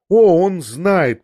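"О, он знает!" drawn out slow, loud and high, the "О" stretched and falling; lost in herself. A narrator's reading. Woman answers him but speaks to herself, slow and loud. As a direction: say it slowly and loudly, as if forgetting the listener. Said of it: "продолжала она отвечать мне ... как будто забыв про меня".

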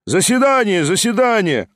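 high, eager repeated call; crowd calling out. A narrator's reading. Members vote aloud from all sides, calling out. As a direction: call out your vote over others. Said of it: "раздалось со всех сторон".

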